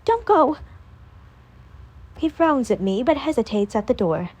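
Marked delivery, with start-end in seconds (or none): 0.0-0.6 high, loud desperate plea; pleading yell. A narrator's reading. She begs boyfriend not to leave, high desperate yell. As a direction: beg him to stay. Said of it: "I yell".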